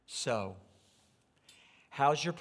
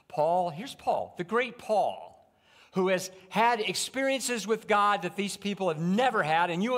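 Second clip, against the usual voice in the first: loud, high, rising sermon climax; fiery preaching; 7 semitones higher, 7 dB louder than usual. Acted. Pastor to the congregation, building to a loud preaching peak.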